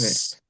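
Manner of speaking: "S" as a bare sustained hiss; letter sound. Shown as a demonstration. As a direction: model just the sound of "S", without the letter name. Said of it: "solamente es el sonido de la letra".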